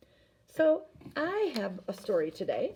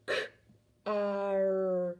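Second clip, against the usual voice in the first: "K-ar" split slowly into its sounds; over enunciating. Shown as a demonstration. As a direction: stretch out "K-ar" sound by sound. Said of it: "stretch out car".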